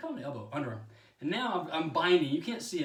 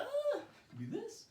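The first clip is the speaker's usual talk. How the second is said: much higher, slow, silly squeak; 5 dB quieter, slower than usual. Shown as a demonstration. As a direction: squeak it out slowly, playful. Repeats phrase in silly voice.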